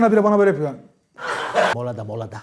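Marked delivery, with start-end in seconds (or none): 1.7-2.4 low mutter. Retold or imitated. Driver signalling from mirror.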